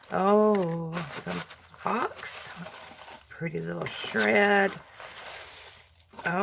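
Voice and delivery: ordinary talk, nothing marked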